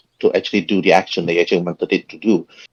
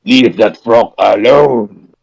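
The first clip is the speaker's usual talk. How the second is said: slow, drawn-out creature command; tree creature voice; 4 dB louder, slower than usual. Acted. Tree creature orders Azula off the frog, slow commanding voice. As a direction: draw out each word like a looming tree creature. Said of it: "he points its woody fingers".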